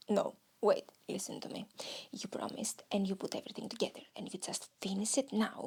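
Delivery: low, hushed voice of reason; stern inner voice. Acted. Inner voice urging the podcaster to finish, hushed and firm. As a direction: whisper it firmly, talking oneself into it.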